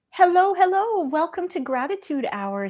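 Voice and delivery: bright, high, eager hello to the stream; excited greeting. Real, not acted. Host excitedly greeting viewers joining the live stream. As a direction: welcome everyone in with a lift.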